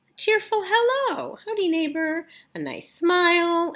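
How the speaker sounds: teacher reading the otter's friendly list, chirpy; cheery sing-song. Acted. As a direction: sell each friendly item with a big smile. Cheerful hello demonstrated.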